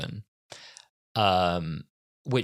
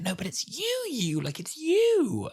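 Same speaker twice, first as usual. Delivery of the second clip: mock breathless joy, pitched far higher, slowing; gushing recognition. Sarah Jane recognising the Doctor, mock gushing joy. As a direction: melt into it with delight.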